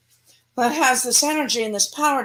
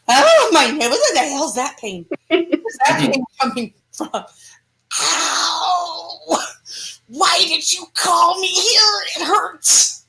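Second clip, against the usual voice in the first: pained demon; 3 semitones higher than usual. Acted. Demon crying out at sudden bodily pain, hammed up. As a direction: yelp like a demon feeling its host's aches.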